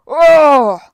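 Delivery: loud, high cry of pain; giant's howl of pain. Acted. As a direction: roar out as if jabbed in the eye. Giant is poked in the eye.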